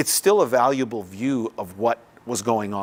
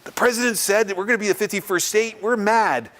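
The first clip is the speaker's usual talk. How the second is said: much higher, lightly put-on indignant voice; mock mad Canadians; 9 semitones higher than usual. Speaker voicing angry Canadian friends about the fifty-first state, mock indignation. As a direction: imitate upset Canadian friends, half-joking. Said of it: "they're all mad 'cause they're like".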